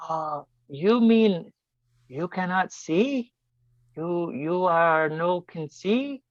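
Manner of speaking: put-on very slow, halting broken English with a foreign accent; imitating the young man's foreign accent, haltingly; slow mock foreign accent, baffled; foreign accent imitation. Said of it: "my accent is definitely wrong".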